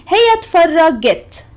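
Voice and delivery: اتفرجت with the g of Cairo and a clear final ت; Egyptian Arabic demo. Shown as a demonstration. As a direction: pronounce هي اتفرجت carefully for learners. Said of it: "اتفرج becomes اتفرجت".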